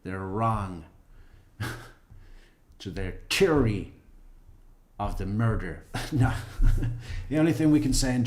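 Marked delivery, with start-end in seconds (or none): 0.0-0.9 lightly put-on Jamaican accent on "They're wrong"; a playful mock Jamaican voice. Shown as a demonstration. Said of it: "How do you say that in a Jamaican accent?". 2.8-5.9 hammed-up mock Jamaican accent, louder; a playful put-on Jamaican lilt. Shown as a demonstration. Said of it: "How do you say that in a Jamaican accent?".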